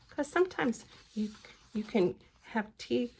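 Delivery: usual speaking voice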